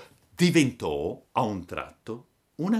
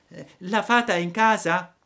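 acuto e forte, da burattino; burattino ansioso; 11 semitones higher, 7 dB louder than usual. A narrator's reading. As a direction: Pinocchio chiama in alto, agitato. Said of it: "domandò il burattino". (Pinocchio chiede alla lumaca, acuto e forte)

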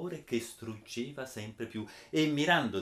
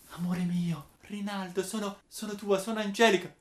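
a higher, tender plea from the maiden Angelica; pleading lover; 7 semitones higher, 3 dB louder than usual. Acted. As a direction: reach out to him, lightly voiced young woman.

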